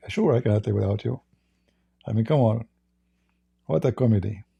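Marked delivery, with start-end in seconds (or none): none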